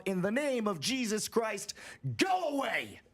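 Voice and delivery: a retold shout into the woods; commanding shout. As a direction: banish the creature in Jesus' name. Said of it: "says something to the effect of".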